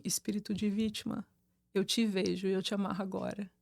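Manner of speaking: softly, right into her ear; barely above a breath, close in; very quietly. Retold or imitated. Binding the spirit into the woman's ear, very quietly. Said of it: "bem baixinho".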